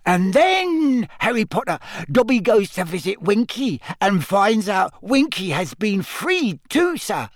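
delighted, very high squeak; Dobby. A narrator's reading. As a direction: squeal the good news in elf voice. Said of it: "said Dobby delightedly".

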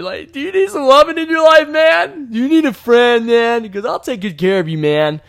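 high, loud, hammed-up sweet-talking voice; mock caring friend. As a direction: coo at the angry pianist like an overdone best buddy. Mock comforting the angry pianist.

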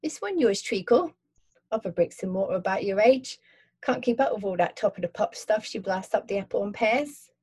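friendly London cabbie asking; Cockney cabbie. Acted. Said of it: "Cockney accent".

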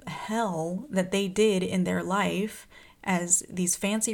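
slow, drawn-out and deliberate; emphatic. Real, not acted. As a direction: lean on every word. Building outrage at parents.

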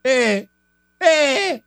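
high-pitched mock shouting in short bursts; imitated quick yelling. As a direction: yelp it like someone flaring up, hammed-up. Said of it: "él rápido grita".

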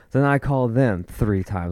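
normal talking voice

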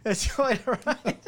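high, slow laughing reply. Real, not acted. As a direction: laugh through the words. Laughing while answering.

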